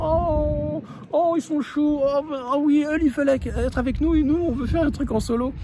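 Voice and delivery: high, put-on voice of disappointed parents; imitating whining parents. Mocking the parents who wanted adult time, high and whiny. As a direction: mimic the grown-ups sulking about being left out. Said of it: "Ils disaient, oh là là".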